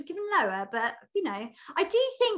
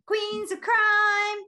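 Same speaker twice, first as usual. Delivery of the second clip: announcer intro; 8 semitones higher, 6 dB louder, much slower than usual. Acted. Announcing segment title.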